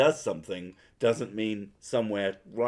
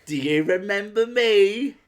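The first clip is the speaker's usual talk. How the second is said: high, louder mock relative quizzing you; pitched-up, over-keen questioning; gushing acquaintance; 10 semitones higher, 7 dB louder than usual. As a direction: play the relative who expects to be remembered. Mocks forgotten relative quizzing him.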